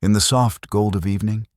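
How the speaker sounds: ordinary delivery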